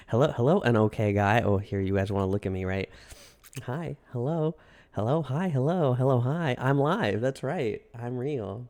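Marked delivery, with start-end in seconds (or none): none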